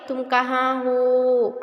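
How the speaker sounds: slow, drawn-out call across a distance; calling out long. Shown as a demonstration. Teacher demonstrates calling someone from afar, drawn out. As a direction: stretch the vowels like calling someone far away. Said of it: "आवाज़ दिए".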